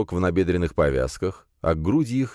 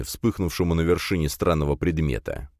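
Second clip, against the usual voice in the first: plain reading voice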